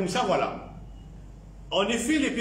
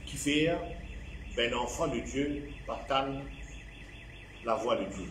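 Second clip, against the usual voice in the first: slow, softer, broken up by long pauses; slow deliberate repeat; 4 semitones lower, 7 dB quieter, slower than usual. Real, not acted. Preacher repeating the key reason to the congregation, slow and deliberate. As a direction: let each phrase sink in, quieter and spaced out. Repeats the point slowly for emphasis.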